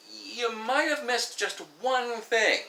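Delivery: high, slow, singsong correction; mock tactful. Telling her she overlooked her anatomy, mock tactful. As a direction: stretch it out high, delicately breaking it to her.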